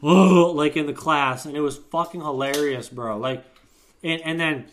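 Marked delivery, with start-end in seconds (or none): 0.0-0.5 loud, raised "oh" imitating the teacher; exaggerated mock holler; mocking "oh" noise. Student mocks the jiggly-necked teacher in class, loud imitated "oh". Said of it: "pictures of that motherfucker going".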